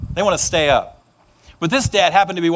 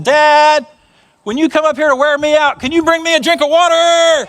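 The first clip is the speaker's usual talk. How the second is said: put-on high, loud persistent child; little boy calling Dad; 7 semitones higher, 5 dB louder than usual. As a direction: plead up to dad, louder at the end. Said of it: "the boy said".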